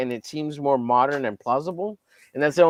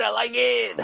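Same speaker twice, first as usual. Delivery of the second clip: raised, goofy, high-pitched; high silly voice; 9 semitones higher than usual. Pretend. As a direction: chirp it up high and playful. Says it twice, differently.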